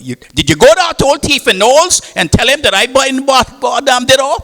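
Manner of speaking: furious wife yelling at her husband; angry wife. Retold or imitated. Wife confronting husband angrily.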